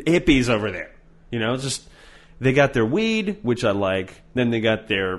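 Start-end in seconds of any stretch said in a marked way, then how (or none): none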